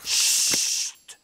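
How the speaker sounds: a sharp, voiceless hiss for silence; sharp shush. Acted. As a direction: hush them at once, library rules. Librarian shushes again in library.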